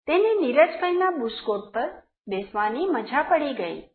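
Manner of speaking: ordinary delivery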